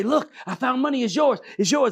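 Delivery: mildly hammed-up excitement; excited. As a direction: blurt it out like a thrilled kid. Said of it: "he was so excited to say".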